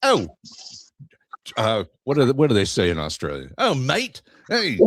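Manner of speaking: high, hammed-up Australian accent; a bad stab at an Aussie farmer. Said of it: "let me do my Austra".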